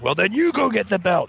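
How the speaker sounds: high, mock-exasperated retort; exasperated dad. Dad snapping back at his kid, mock exasperated yell. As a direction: throw the order back, high and pushed.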